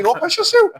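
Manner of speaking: a teasing, hammed-up comeback; mock cheeky retort. Mock answer to toilet request.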